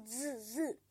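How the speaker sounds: "z, z" as a soft buzzing zzz; buzzing z sound. Shown as a demonstration. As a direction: buzz the "z, z" with a soft hum. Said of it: "Elle fait toujours z".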